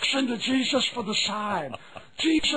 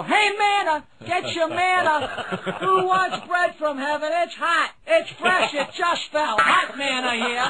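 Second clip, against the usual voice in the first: high hawker's call, pitched up; sing-song market hollering; 5 semitones higher than usual. Acted. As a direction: holler wares to the ballpark crowd. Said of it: "You can hear the vendors".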